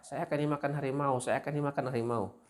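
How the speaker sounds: high-pitched mock alarm; berteriak. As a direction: play the farmer crying tiger. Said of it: "petani ini berteriak lagi". (seruan bernada tinggi, memperagakan petani)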